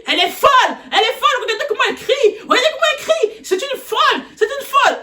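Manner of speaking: very high mock accusing voice; hammed-up high teacher voice; imitating shrieking teacher. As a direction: point her out as crazy in a shrill mock voice. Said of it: "la prof, elle dit".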